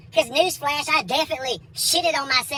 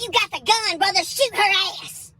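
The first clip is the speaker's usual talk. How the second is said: mock urgent egging-on; 8 semitones higher than usual. Comic urging the bailiff on, hammed-up urgency. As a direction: urge him on, pitched way up and stretching the end.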